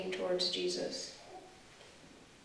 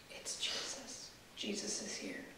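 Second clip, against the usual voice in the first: relieved whisper; 9 dB quieter than usual. Acted. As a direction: breathe it like a secret comfort. Relief in guided meditation.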